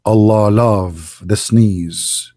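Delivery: very slow, low and drawn out; mock solemn pronouncement. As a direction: intone it gravely, stretching every word. Solemn mock quote, then incredulous repeat.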